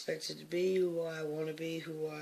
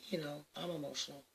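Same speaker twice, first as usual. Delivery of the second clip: ordinary delivery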